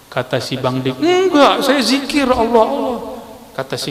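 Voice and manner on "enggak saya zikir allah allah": insist, offended, you weren't asleep; mock-indignant high denial. Bang Dik denies sleeping, argues.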